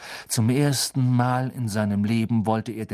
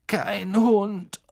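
high, strained, loud groan; groaning in pain; 11 semitones higher, 6 dB louder than usual. Acted. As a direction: force it out through the pain. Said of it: "stöhnte Ron und biss vor Schmerz die Zähne zusammen".

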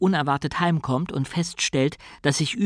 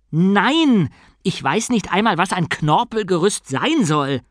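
loud, higher, distressed denial; agitated protest; 5 semitones higher, 5 dB louder than usual. A narrator's reading. Bruta denying he understands his new knowledge, agitated. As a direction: burst out in bewildered protest.

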